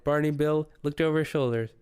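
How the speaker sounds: ordinary delivery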